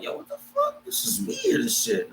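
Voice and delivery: hushed, incredulous muttering to oneself; inner thought. Retold or imitated. His silent thought, voiced hushed.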